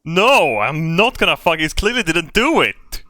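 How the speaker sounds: loud, exasperated shouting; angry yell. Real, not acted. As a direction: yell it back in frustration. Player yelling back at narrator.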